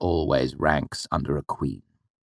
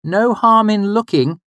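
a higher, slow, sensible mother; 6 dB louder, slower than usual. Acted. The mother sending her son off, sensibly. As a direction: reason it out easily. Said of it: "she said sensibly".

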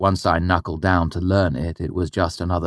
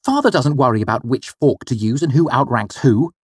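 put-on a grumbling boy, pitched up; grousing; 7 semitones higher, 4 dB louder than usual. The boy grousing to his mother, in a sulky voice. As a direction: complain sulkily, ending on a rise. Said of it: "I groused".

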